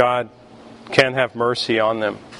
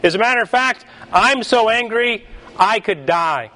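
mock defiant Jonah; 8 semitones higher than usual. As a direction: toss it back at God like a cocky Texan. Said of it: "He confidently blew right by the question".

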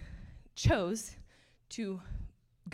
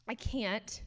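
normal talking voice

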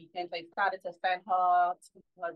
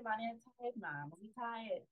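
tired mumble; 12 dB quieter than usual. Retold or imitated. Mother quoting her own tired reply.

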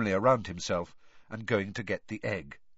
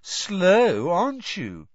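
cheerful mocking; 7 semitones higher, 5 dB louder, slower than usual. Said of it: "Moaning Myrtle so cheerful".

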